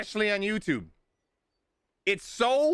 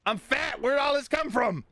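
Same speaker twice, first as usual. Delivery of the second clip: higher, mock horror at his own body; hammed-up shock at being fat; mock shocked self; 8 semitones higher than usual. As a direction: gasp it out like a sudden discovery. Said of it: "I didn't just wake up this morning and go".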